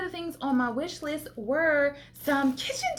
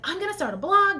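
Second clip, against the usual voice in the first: excited; 7 semitones higher, 4 dB louder, faster than usual. Retold or imitated. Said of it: "all excited about it".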